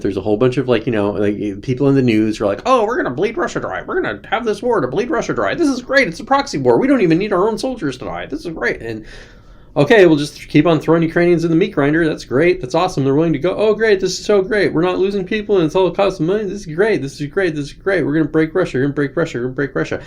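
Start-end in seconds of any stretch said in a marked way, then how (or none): 2.7-8.8 higher, mocking cheerful voice of a smug planner; mock gleeful official. Ridiculing officials who cheer the proxy war, mocking glee. 9.8-20.0 higher, mocking cheerful voice of a smug planner; mock gleeful official. Ridiculing officials who cheer the proxy war, mocking glee.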